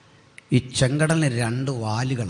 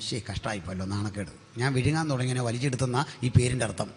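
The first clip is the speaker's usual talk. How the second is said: much softer, acted grumble; embarrassed aside; 7 dB quieter, faster than usual. Nile laments losing what it swallowed, embarrassed aside. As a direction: drop the voice as if ashamed.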